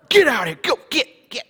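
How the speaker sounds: loud, high shooing a cow; shooing shout. Retold or imitated.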